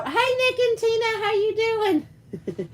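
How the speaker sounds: high bright hello to arriving friends; excited greeting. Real, not acted. Streamer greeting viewers Nick and Tina as they arrive, high and cheerful. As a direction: sing out their names up high.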